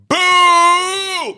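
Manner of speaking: long, high, loud boo; crowd boo. Retold or imitated. Mimicking the crowd booing the comic with a high loud boo. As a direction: boo him off like an angry crowd.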